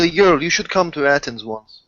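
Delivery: ordinary talk, nothing marked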